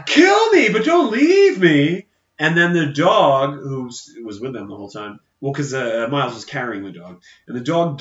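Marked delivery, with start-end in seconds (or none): 0.0-2.1 high, loud hammed-up shouting; mock desperate plea. Acting out Miles left in the desert, mock desperate shout. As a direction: wail it as an overacted plea to a captor. Acts out abandoned character pleading.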